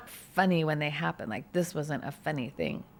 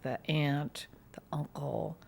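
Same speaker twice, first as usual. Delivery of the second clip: whisper; 3 semitones lower, 7 dB quieter, slower than usual. Real, not acted. Naming the grieving family.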